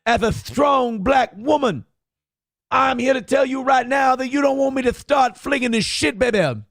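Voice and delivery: a mocking impression of her speech turned Dusty Rhodes; mock Dusty Rhodes preacher. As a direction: ham it up like a wrestling promo, building to a shout. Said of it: "it's starting to be Dusty Rhodes a bit".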